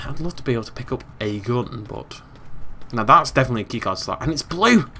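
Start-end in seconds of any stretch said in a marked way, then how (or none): 4.2-4.9 raised, louder note of delight; mildly excited exclamation; pleased discovery. Real, not acted. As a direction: perk up at the find. First take of excited discovery.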